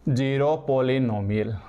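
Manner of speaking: slow, stretched-out term; name the term slowly as if writing it; dictation pace. Real, not acted. Teacher writing a new term for students, slow dictation.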